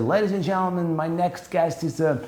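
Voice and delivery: high-pitched talk show host introduction; Jimmy Fallon. Retold or imitated. Host introduces a European comedian, high announcer imitation. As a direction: imitate an excited host bringing out a guest. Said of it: "first time on Jimmy Fallon".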